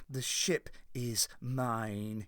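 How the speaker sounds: low, soft, slow menace; intense quietness. A narrator's reading. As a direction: hold it quiet and dangerous. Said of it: "he said with an intense quietness".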